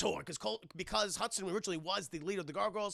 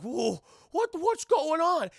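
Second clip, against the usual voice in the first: high, loud acted-out disbelief; shocked outburst; 10 semitones higher, 10 dB louder, slower than usual. Retelling Cold Stone seeing them hugging, shocked high shout. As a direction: cry it out like a stunned character.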